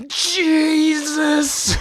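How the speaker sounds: high hammed-up growl of Jesus; mock growly wrestler yell. Mocking the wrestler's promo, hammed-up growly yell. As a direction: parody the screaming wrestler. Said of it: "So unnecessarily growly and".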